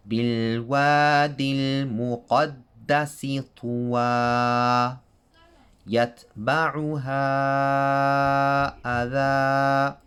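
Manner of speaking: تلاوة القرآن; Quranic recitation. Shown as a demonstration. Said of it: "recites examples".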